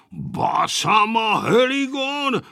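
high, drawn-out exclamation; exclaiming. A narrator's reading. Grandfather spotting the children, exclaiming. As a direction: cry it out as a startled grandfather. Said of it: "vykřikl dědeček".

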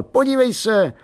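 put-on high, excited boy calling his mum; excited high-pitched call. A narrator's reading. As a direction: call for attention, pitched right up.

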